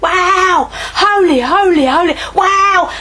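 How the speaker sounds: loud, high cry of wonder, arms thrown wide; awed exclamation. Retold or imitated. Elders before the throne crying out in awe, loud and high.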